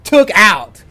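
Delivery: loud, emphatic, with pauses for effect; loud, thrilled hype; excited bragging. Real, not acted. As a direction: punch it out loud, phrase by phrase. Bragging about an epic solo win.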